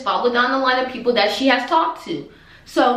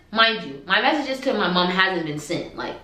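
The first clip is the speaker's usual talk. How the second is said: usual speaking voice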